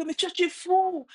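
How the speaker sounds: high, exasperated mock outburst; imitated frustration. Boy insults the answering voice, mock frustrated. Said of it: "le petit commence à être frustré".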